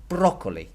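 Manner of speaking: "broccoli" as BRO-co-li, first syllable high and loud; "broccoli" hit hard at the start, then falling; stress on first syllable. Shown as a demonstration. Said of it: "that's where the accent is".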